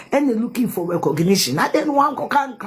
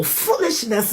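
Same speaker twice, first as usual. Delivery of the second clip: ordinary talk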